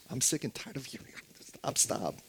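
hushed mock protest; imagined weary complaint. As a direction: grumble it under your breath as a fed-up listener. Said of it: "you may be thinking".

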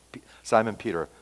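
ordinary talk

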